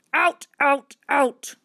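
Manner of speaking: loud, punched repeated order; angry man. A narrator's reading. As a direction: shout each word to drive them out.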